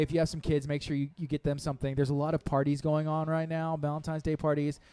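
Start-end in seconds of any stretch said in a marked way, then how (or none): none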